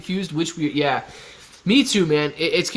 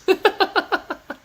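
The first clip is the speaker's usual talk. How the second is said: a squealing high laugh; high cackle of a laugh; high burst of laughter. Real, not acted. Big laugh at joke.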